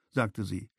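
plain reading voice

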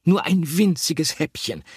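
a greedy little mouse wheedling himself; pleading mouse voice, hungry and eager; longing plea. A narrator's reading.

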